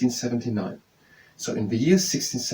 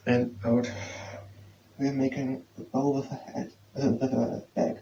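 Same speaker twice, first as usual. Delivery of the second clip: soft, calm guiding voice for a stretch; yoga instruction; 4 dB quieter than usual. Acted. Teacher guiding students through standing yoga, calm and soft. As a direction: talk the class gently through cat and cow.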